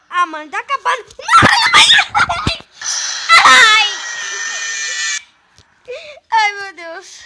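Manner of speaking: loud laughing panic and cries; panicking gamer. Real, not acted. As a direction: shriek and groan in fright. Final jumpscare. (gamer em pânico)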